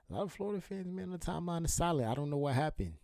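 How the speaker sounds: high-pitched mock puzzlement; teasing in a high, put-on voice; mock bafflement. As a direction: pitch it way up, feigning cluelessness. Mock bafflement at silent Florida fans.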